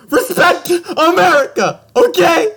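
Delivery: high, loud, laughing mock outrage; hammed-up patriotic yell, half laughing; mock indignant shout. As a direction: Steve Rogers scolding a rude moviegoer, high and loud. Steve voiced in retelling.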